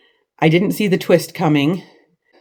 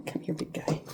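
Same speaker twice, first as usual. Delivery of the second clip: hushed, coaxing the cat over; soft coaxing to a pet; 18 dB quieter than usual. Real, not acted. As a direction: gently call the cat close. Calling the cat softly.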